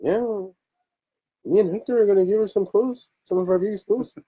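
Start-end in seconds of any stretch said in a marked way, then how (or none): 1.4-4.2 put-on high, playful heavy Mexican accent. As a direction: ham up a thick Mexican accent. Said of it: "I hit her with the heavy Mexican accent".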